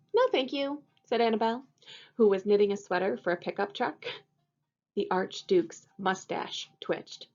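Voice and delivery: storybook reading. A narrator's reading. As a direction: read it to the kids with bright expression. Teacher reads picture book aloud.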